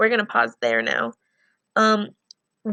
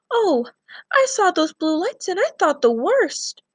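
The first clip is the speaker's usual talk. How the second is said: higher anxious grandma voice, shaken; worried grandmother; 8 semitones higher than usual. Acted. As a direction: voice a frightened grandmother relieved her boy is safe. Her hands are shaking, she's saying.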